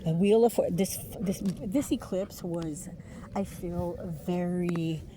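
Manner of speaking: ordinary talk, nothing marked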